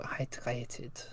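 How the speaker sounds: hushed attempt at a German accent. Shown as a demonstration. Friend tests hydrated on request, in a German accent. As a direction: try it quietly in a German accent. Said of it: "Just say hydrated in a German accent".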